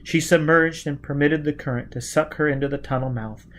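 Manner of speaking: ordinary delivery, nothing marked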